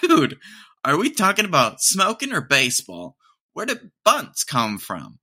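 can't believe what you just heard; incredulous. Retold or imitated. Asking friends if it's smoking or baseball, amazed. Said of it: "And I was like".

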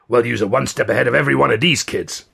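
surly New Yorker voice; New York accent. A narrator's reading. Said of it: "surly New York accent".

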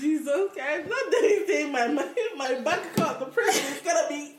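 high, loud talk through laughter; cracking up. Real, not acted. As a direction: barely get it out for laughing. Cracking up at friend's rant.

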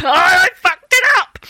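high, loud laughing yelp of panic; mock-yell it through a laugh; half-laughing cry of dismay. Panics after sending the self-tape.

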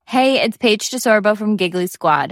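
ordinary talk

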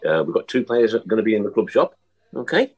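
ordinary talk

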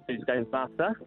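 ordinary delivery

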